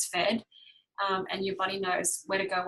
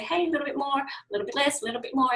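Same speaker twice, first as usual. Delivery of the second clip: coaxing balance voice; 5 semitones higher, much faster than usual. Pretend. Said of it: "then you say".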